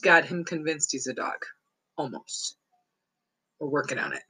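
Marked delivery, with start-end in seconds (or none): 2.0-4.2 Almost dropped much softer, lower, to yourself; quiet aside. Real, not acted. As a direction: mutter Almost under your breath as a wry afterthought.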